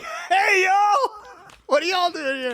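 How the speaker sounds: high-pitched playful shout; hammed-up surprise arrival, hollered high; mock hollering greeting. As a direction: call out in a comically high voice. Acting out Desmond's sudden arrival.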